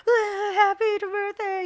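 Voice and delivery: high, drawn-out birthday squeal; excited birthday greeting. Retold or imitated. High mom bursting into her son's room at midnight, excited. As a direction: burst in with a stretched, high-pitched cheer. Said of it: "It was like".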